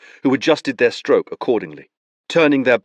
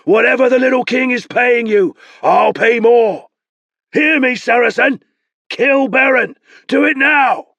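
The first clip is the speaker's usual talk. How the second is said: shouting; 8 dB louder than usual. Acted. Nabor shouting bribes across river.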